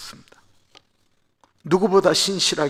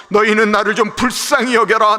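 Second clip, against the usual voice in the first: pleading for pity; 8 semitones higher, 9 dB louder than usual. Acted. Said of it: "자존심을 내려놓고 하는 말".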